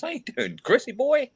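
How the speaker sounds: bright greeting. Real, not acted. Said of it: "greeting stream viewer".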